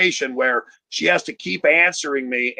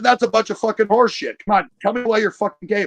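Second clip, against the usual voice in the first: higher, pushy retort; exasperated son; 6 semitones higher than usual. Acted. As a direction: press for the real answer, impatiently. Son rejects mom's sappy answer.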